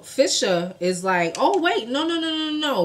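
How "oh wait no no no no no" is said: excited interruption. Retold or imitated. Fisher eager interruption.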